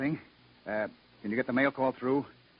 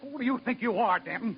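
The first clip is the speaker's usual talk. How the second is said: indignant; 13 semitones higher than usual. Acted. Captain rebukes defiant engineer.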